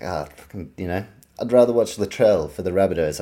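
ordinary talk

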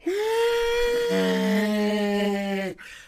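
drawn-out, high screeching gasp; exaggerated shocked intake, held long. Reliving the friend's comeback, long high shocked gasp. Reacting to his brutal comeback.

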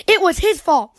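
cub's high accusation. Acted. Cubs blame each other again.

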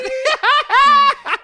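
a loud, high burst of laughter. Real, not acted. Delighted laugh after request.

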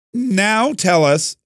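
high, slow delivery with a cracking voice; real accidental voice crack. Real, not acted. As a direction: let the voice break upward on drawn-out words. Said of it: "sorry, my voice cracked".

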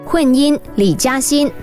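ordinary talk, nothing marked

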